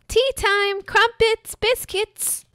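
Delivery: high, singled-out calls to lure someone; calling out. Pretend. Player luring lost friend with British foods, high calling. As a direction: call each treat out high like bait.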